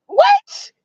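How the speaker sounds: squeaked way up high in disbelief; a stunned falsetto what; jaw-drop shriek of what; shocked what. Real, not acted.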